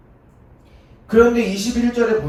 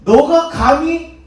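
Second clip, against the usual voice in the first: slow, louder, higher "how dare you"; outraged; 10 semitones higher, 10 dB louder, much slower than usual. Acted. Enraged crowd confronting Jesus, slow furious challenge. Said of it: "이 사람들이 열받은 거예요".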